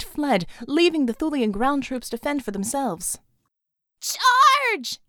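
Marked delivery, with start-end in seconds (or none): 4.0-5.0 loud high battle order; battle shout. Acted. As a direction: yell the charge. Ordering troops to attack.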